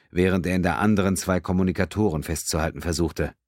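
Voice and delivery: usual speaking voice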